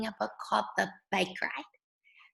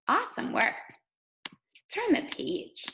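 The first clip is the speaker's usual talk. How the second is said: ordinary talk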